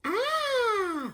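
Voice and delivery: very high, steady, held "Ah" like a bell; high fairy tone. Shown as a demonstration. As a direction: ring out a pure high note like an electronic bell. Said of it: "it goes like this".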